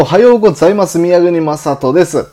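loud, bright show opener; upbeat greeting. Real, not acted. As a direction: greet listeners with big energy. Energetic podcast opening greeting. (元気いっぱいの挨拶)

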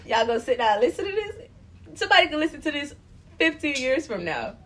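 熱弁; emphatic preaching. Real, not acted. Preaching to future listeners.

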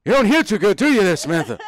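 put-on loud, high, needling old-man voice; hammed-up cranky old-timer; George. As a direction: holler teasingly like a crotchety patient. Old patient mocking caller's hearing.

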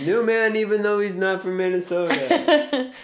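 back-announce the track like a hammed-up DJ; mock radio DJ.

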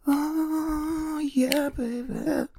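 very high, loud, stretched squeal; mock ecstasy. Host reacts to the beer crack, high mock-ecstatic voice. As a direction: draw it out high in pretend ecstasy.

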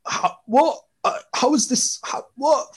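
high, baffled how what; stunned disbelief. Retold or imitated. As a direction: gasp in disbelief. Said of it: "His face was just like".

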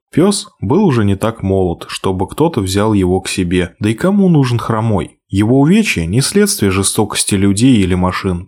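ordinary delivery, nothing marked

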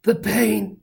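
high, melodramatic wail. A narrator's reading. Hero pretends to die before crowd, wailing. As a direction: fake a mortal wound. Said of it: "wailed Johnny".

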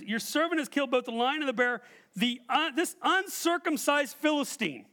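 punk-style taunt, stretched at the end; cocky mockery. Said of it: "punk moments".

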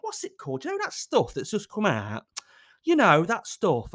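high, put-on vague partner; feigned forgetfulness. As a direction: imitate someone stalling over a name. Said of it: "makes out she can't remember".

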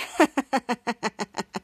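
low staccato villain chuckle; long, evenly pulsed pretend chuckle; evil chuckle. Retelling Evil Ray's reaction, low acted chuckle. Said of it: "Cut to Evil Ray chuckling".

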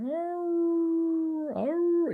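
high, whiny dog whimper; imitated dog whine. Pastor imitates the stray dog whining to him, high whimper. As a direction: whimper like a needy dog begging. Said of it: "he just sat there going".

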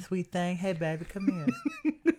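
high, lisping ladies' man; Leon Phelps. Acted. Imitating movie ladies' man's come-on, high lisp. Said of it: "You got a lisp".

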